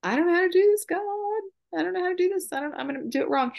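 high, pleading, near-frantic cry; desperate cry to God. Retold or imitated. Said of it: "I cried out to God".

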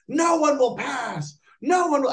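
high, slow mock wizard proclamation; guard the wall like a doomed wizard. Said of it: "if I look like a wizard".

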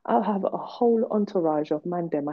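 ordinary talk, nothing marked

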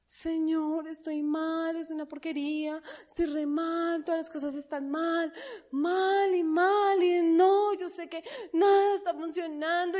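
whining, slowed, tearful complaint; a sobbing, moaning prayer mocked; mock wailing prayer. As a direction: ham up a self-pitying prayer.

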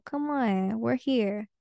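ordinary talk

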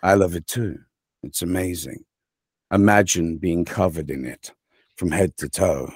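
low, soft, lingering sweet talk; mock seductive. Star treatment for a fan who loves his accent, mock seductive. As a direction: croon it softly, as if wooing an admirer.

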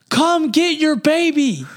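high, slow, drawn-out call across the field; a mother hollering from the sideline; imitated mom calling to her son on the field; mom yelling. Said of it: "My mom is yelling at me from the sideline".

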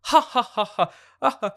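loud, high mock laugh; hammed-up ha-ha-ha; imitated group laughter. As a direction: mimic a roomful laughing along. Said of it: "Everyone's laughing".

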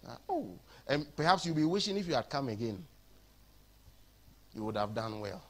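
ordinary delivery

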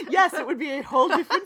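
can't stop laughing at her own joke. Real, not acted. Laughing with her co-host over the Australian summer joke.

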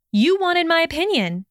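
high, indignant retort. A narrator's reading. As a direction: throw it back at him, pitched well up. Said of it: "she retorted".

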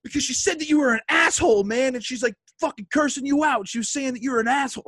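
high, fast mock tirade; imitating angry outburst. Wrestler imitating his friend explaining who insulted him, angry high tirade. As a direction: tumble it out in a high, indignant impression. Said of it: "Why are you so angry?".

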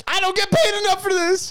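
squeaky whining complaint; high whiny voice. Retold or imitated. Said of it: "He's like".

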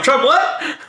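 high-pitched incredulous laugh-talk; laughing mock question. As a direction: blurt it through laughter. Laughing self-quote.

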